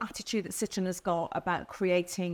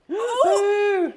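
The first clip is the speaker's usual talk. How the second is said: gasp; 12 semitones higher, 10 dB louder than usual. Real, not acted. Reacts to driving news.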